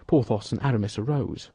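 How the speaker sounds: ordinary delivery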